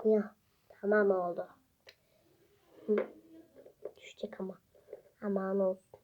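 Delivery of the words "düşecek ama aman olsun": whispering. Real, not acted.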